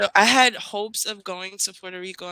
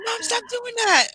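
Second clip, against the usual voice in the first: 1 annoyed son; 4 dB louder than usual. Retold or imitated. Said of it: "he's like".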